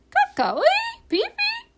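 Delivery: very high, loud bird call; piercingly high parrot cry; bird language. Acted. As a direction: squawk it out as parrot talk. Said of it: "in bird language".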